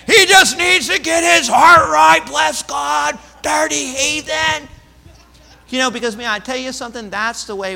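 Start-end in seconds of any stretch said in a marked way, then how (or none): 0.1-4.7 shouted, much louder condemnation, slow at the end; mock angry preacher shout. Pastor mocking a harsh church response to honest doubt, angry shout. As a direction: bellow it like a judgmental preacher. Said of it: "Jesus didn't say".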